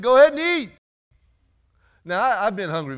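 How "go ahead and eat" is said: much higher and louder urging; the voice tells Peter to eat, high and loud; heavenly voice urging. Acted. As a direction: call it out like a voice from above. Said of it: "this voice is saying".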